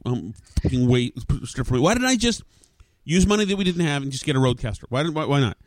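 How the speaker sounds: usual speaking voice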